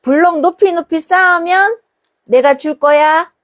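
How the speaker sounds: mother's sing-song promise; sing-song mom. Retold or imitated. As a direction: coax a toddler to stack blocks. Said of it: "자".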